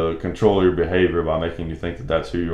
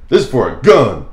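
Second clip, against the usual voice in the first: higher, louder flat accusation; suspicious cashier; 5 semitones higher, 8 dB louder than usual. Retold or imitated. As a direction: imitate the cashier's pointed disbelief. Cashier repeats objection, suspicious.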